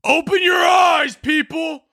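high, shouting conspiracy nut; high-pitched mock shout of a conspiracy theorist; mocking conspiracy poster. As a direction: yell it like a frantic online truther. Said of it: "people online that are like".

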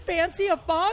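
incredulous echo. Retold or imitated. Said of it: "Oh my God, that's genius".

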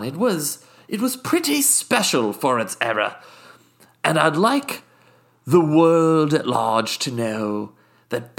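haughty British guest, smug and unhurried; a snooty Brit savouring every indiscretion; posh British accent. Acted. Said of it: "my British accent slips".

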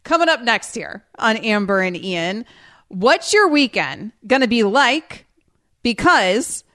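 stretched-out radio tease with pauses; deliberately slow. Real, not acted. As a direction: read the tease slowly, stalling between phrases. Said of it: "I'm trying to talk so slowly".

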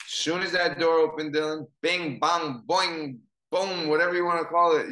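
bing, bang, boing, boom called out loud like a cartoon brawl; cartoon fight noises. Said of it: "You ever see the cartoons?".

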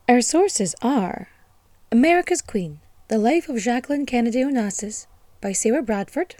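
loud, proclamatory reading of titles; grand source reading. Acted. Host reading her sources aloud, loud proclamation. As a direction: announce each book like a herald.

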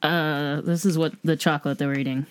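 ordinary talk

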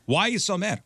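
high, taken-aback question; mock alarmed questioner. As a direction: ask it high, as if being yelled at. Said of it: "Everything sounds angry".

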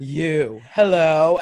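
a loud, wide-eyed hello, building from a plain start; stunned locals calling out to the newcomer; mock gawking hello. Pub locals stunned by a newcomer, loud wide-eyed hello.

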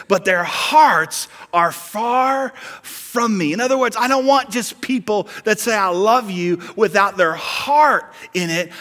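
loud, high, driving sermon climax; full-force preaching. Acted. Pastor urging the congregation toward real love, loud and high. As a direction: preach it out with rising fervor.

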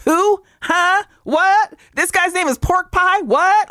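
high, confused questions; squeaky bewildered asking; baffled high squeak. Retold or imitated. Confused quote, pitch way up.

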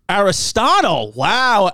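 normal talking voice

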